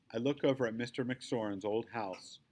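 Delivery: usual speaking voice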